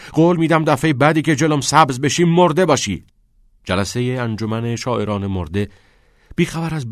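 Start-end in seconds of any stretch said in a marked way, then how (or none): 0.1-3.0 loud, high bellowed threat; furious bellow. A narrator's reading. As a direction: roar it as a threat. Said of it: "چت دوباره عربده کشید". (عربدهٔ تهدیدآمیز)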